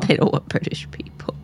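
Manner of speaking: breathy mock-secret whisper. Whispered echo of friend's joke.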